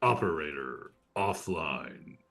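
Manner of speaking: slow flat machine voice; mechanical voice. Acted. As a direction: speak like a cold ship computer. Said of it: "a mechanical voice will echo out".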